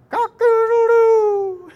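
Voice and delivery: high, slow crowing cock-a-doodle-doo; rooster crow. Acted. Preacher imitating the rooster that crowed, high slow crow. Rooster crows after denial.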